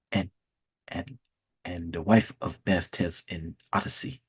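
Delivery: quiet Nigerian professor trailing off into a whisper; low monotone Nigerian accent. Retold or imitated. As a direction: mumble the assignment low and flat. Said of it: "they always talk in monotone".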